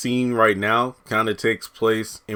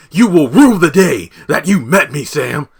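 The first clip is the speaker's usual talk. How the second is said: high, loud, hammed-up villain vow; Neville's threat; 5 semitones higher, 9 dB louder than usual. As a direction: swear revenge like a cartoon villain. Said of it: "Sam pulls a Neville from iCarly".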